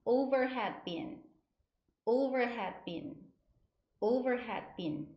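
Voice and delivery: "Overhead bin" slow, falling at the end; repeat-after-me demo. Shown as a demonstration. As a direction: model "Overhead bin" deliberately for students. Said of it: "세 번 따라해 보실게요". ("Overhead bin"을 따라 하도록 천천히)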